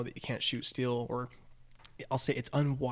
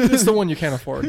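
loud, raised words through real laughter; laughing; 7 semitones higher, 11 dB louder than usual. Real, not acted. Storyteller joking about an unaffordable Maserati, laughing. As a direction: laugh out the punchline. Cracking up at the joke.

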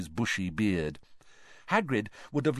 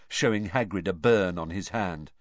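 usual speaking voice

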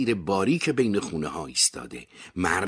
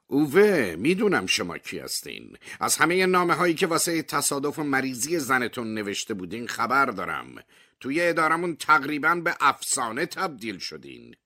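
lightly voiced higher official, unbothered; cool official; 8 semitones higher than usual. A narrator's reading. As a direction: address him coolly, a touch higher.

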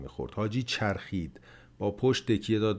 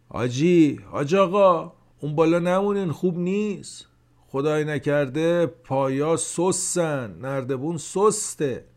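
بلند گفتن؛ صدای زیر و بلند و نگران؛ با صدای بلند هشدار بده؛ هشدار بلند همراه به حاجی; 7 semitones higher, 5 dB louder than usual. A narrator's reading. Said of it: "بلند گفت".

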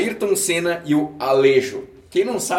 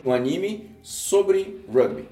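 ordinary talk

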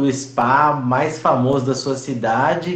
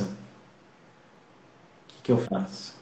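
whispered aside; 6 semitones lower, 12 dB quieter, much slower than usual. Real, not acted. Audio lag, muttered aside.

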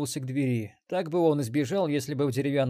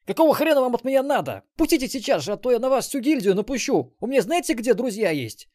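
loud, high indignant ranting; angry threats; 11 semitones higher, 8 dB louder than usual. Acted. Captive marauder threatening interrogators, loud high anger. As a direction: shout threats while held down.

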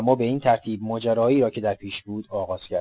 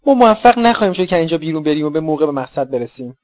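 louder, anxious protest; worried outburst; 5 semitones higher, 6 dB louder than usual. Acted. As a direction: blurt out the fear. Fearful companion during escape. (اعتراض نگران و بلندتر)

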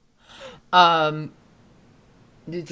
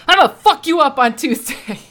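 loud, higher mock threat; hammed-up tough-guy threat, louder and higher; menacing threat; 9 semitones higher, 6 dB louder than usual. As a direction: bark the threat as you kick the door in. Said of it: "Kicks down the door".

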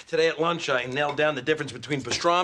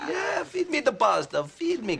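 put-on high cocky accent; Rico accent; 11 semitones higher than usual. Husband in Rico voice demanding pasta.

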